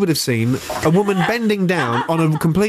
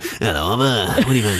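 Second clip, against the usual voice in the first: low, half-laughed barman impression; jokey TV barman voice; Dave the barman; 5 semitones lower than usual. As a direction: mimic the pub barman, dropping the pitch. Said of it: "Dave the barman".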